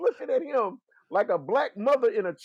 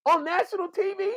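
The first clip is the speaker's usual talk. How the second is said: shocked; 10 semitones higher than usual. Retold or imitated. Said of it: "You're, you're like".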